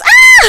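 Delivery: very high, loud squeal; piercing squeal of delight; excited squeal. Real, not acted. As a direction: shriek with excitement. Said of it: "I'm so excited".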